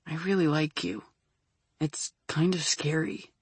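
soft, hushed confession; whisper. A narrator's reading. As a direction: whisper it shyly.

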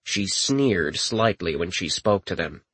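ordinary delivery

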